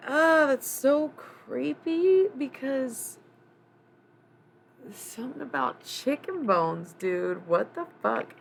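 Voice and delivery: high, loud creeped-out exclaim; creeped-out outburst. Real, not acted. Reacts to creepy chicken bones.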